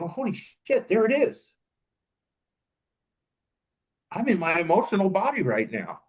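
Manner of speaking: sudden high-pitched "oh holy shit" discovery; surprised realization. Retold or imitated. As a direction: catch yourself in the moment, startled and high. Realizing inner feeling is back.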